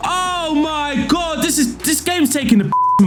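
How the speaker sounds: high, drawn-out oh my God; stunned outrage. Real, not acted. Player reacting to the late equaliser, stunned and high. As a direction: hit by the late goal, disbelieving.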